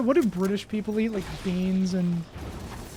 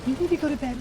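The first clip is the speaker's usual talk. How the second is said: mock parent; 4 semitones higher, 6 dB quieter, faster than usual.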